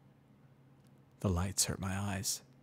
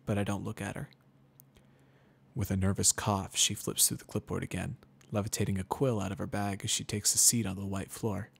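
ordinary delivery

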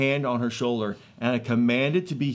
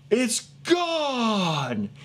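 higher, retold exclamation of amazement; raised cry of wonder; astonished; 7 semitones higher than usual. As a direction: mimic her joyful disbelief. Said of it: "She goes".

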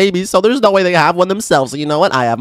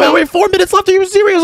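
much higher, incredulous squeal; shocked disbelief; 12 semitones higher than usual. Real, not acted. Player hears only four minutes remain, shrieks in high disbelief. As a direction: sound stunned that time is almost up.

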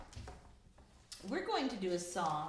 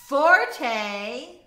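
much louder, higher musical term; loud forte; 4 semitones higher, 12 dB louder than usual. Shown as a demonstration. Teacher listing dynamics for students to repeat, loud. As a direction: belt out the term boldly. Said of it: "in order from quietest to loudest".

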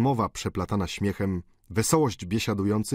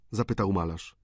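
ordinary delivery, nothing marked

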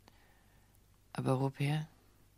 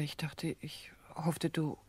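faltering whisper; 7 dB quieter, slower than usual. Acted. Woman confesses to husband.

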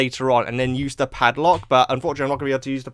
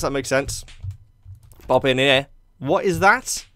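ordinary delivery

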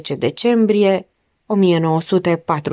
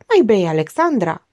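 ordinary delivery

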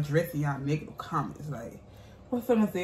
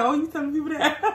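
laughing mock quote; 10 semitones higher, 3 dB louder, faster than usual. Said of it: "Shawty looking like".

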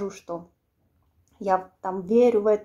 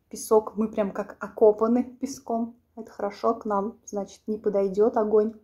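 chewing while talking; eating between words; mouth full. Real, not acted. Seen 1: Fork repeatedly brings food into her mouth while speaking; chewing visible.

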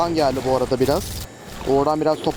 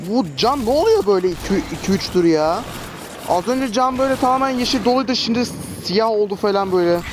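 higher, puzzled protest about the health bar; baffled complaint; 6 semitones higher than usual. Real, not acted. Gamer puzzled by his health bar dropping, baffled. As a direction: sound genuinely confused and put out.

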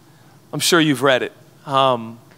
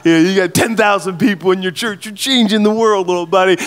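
put-on a fond heavenly father cooing at a proud little kid, louder, with a touch of sing-song; doting God voice; 4 dB louder than usual. God in heaven teasing proud churchgoers, a hint of a doting father. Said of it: "I think God's in heaven going".

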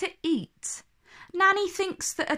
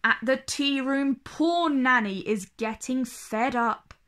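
ordinary delivery

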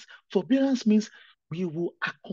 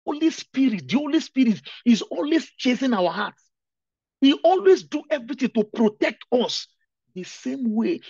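raised, loud preacher's cry about the Spirit; fervent preaching; 6 semitones higher, 8 dB louder than usual. Acted. Pastor exhorting the congregation about the Holy Spirit, loud and fervent. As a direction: lift the whole church with it.

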